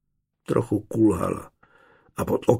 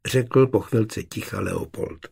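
plain reading voice

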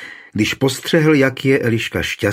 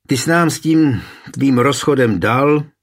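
raised-pitch, annoyed reproach; grumpy; 5 semitones higher, 4 dB louder than usual. A narrator's reading. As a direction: greet him with a grumbling complaint. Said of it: "uvítal ho mrzutě". (mrzutě, vyčítavě)

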